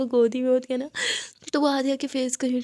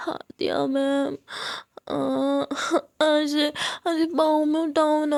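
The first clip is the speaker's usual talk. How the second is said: put-on drunk pleading; 3 semitones higher, slower than usual. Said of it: "अपनी नशीली आंखों के साथ".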